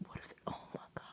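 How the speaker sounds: scared whisper. Real, not acted. Alone in her room at night, frightened whisper. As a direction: whisper it so nothing hears you.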